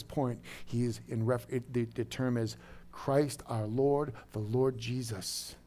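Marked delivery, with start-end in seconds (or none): none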